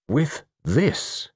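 high, self-important announcement. A narrator's reading. Mister Satterthwaite produces the glass fragment, self-important. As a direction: announce it as your big moment. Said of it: "said Mister Satterthwaite importantly".

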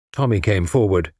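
ordinary delivery

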